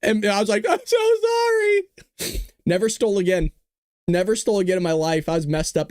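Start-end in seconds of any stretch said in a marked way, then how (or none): 0.7-1.8 very high, crying squeal; mock tearful apology. Five-year-old apologizing to the store lady, mock crying. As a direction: wail it like a hammed-up sobbing little kid.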